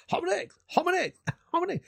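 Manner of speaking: mock British accent, high-pitched "hahm and eggs"; imitated English accent. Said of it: "what he's trying to say".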